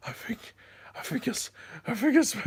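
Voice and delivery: too scared to get the words out; breathless fright. Retold or imitated. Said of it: "I couldn't even talk".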